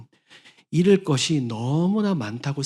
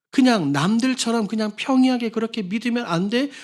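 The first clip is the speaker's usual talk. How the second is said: scornful nagging; 7 semitones higher than usual. Retold or imitated. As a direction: scoff at someone too eager at church. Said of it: "이런 소리들 들으신 경험들".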